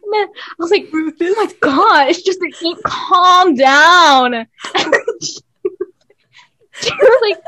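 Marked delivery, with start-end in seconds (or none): 0.9-4.5 slow, loud, high mock protest; drawn-out exasperated plea; retold exasperation. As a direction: drag it out, begging mom to chill. Sibling reacting to mom's angry rant.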